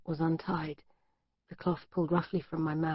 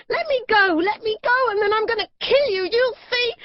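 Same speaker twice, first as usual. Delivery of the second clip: mad high-pitched shout; 8 dB louder than usual. Acted. Captive girl screaming at her captor, mad and high-pitched. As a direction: scream it at him, unhinged. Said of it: "I shouted in a mad, high-pitched voice".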